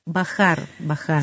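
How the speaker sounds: soft, hushed demonstration. Shown as a demonstration. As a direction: drop the volume as you advise it. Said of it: "el volumen cuando les hablamos".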